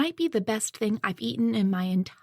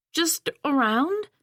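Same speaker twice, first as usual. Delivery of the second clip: talking through a mouthful; words round a bite of steak; mouth full; 6 semitones higher, 3 dB louder, slower than usual. A narrator's reading. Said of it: "speaking with my mouth full".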